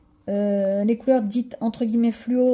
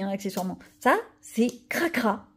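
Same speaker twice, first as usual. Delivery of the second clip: normal talking voice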